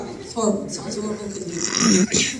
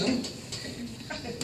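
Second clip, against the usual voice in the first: quiet retreat; 2 semitones higher, 10 dB quieter, much slower than usual. Retold or imitated. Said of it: "he never bothered me when I'm quiet".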